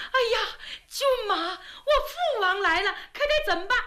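high, panicky half-sob; a scared young princess near tears; frightened cry. A narrator's reading. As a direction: princess panicking as her father arrives, high and tearful. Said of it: "瑞仙吓得心蹦蹦直跳".

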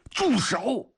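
high, forceful cry to stop; urgent shout. A narrator's reading. Comrade runs to stop a man firing, shouting urgently. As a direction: call out to halt him at once.

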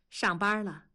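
ordinary delivery

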